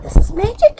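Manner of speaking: high, delighted gasp of wonder; awed and delighted; marvelling. Acted. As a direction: sound enchanted by it all. Middle marvels at magic.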